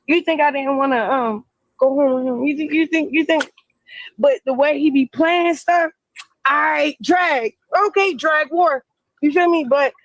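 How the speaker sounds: ordinary talk, nothing marked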